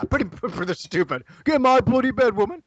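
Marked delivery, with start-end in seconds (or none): 1.4-2.6 loud, high, hammed-up British accent; put-on British accent, pitched up; mock British accent. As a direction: bellow it as a brash British caricature. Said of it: "love the British accent".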